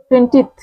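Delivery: টোয়েন্টিথ with a clear th ending, no n; careful pronunciation. Shown as a demonstration. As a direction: model টোয়েন্টিথ for the class. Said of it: "এন হবে না".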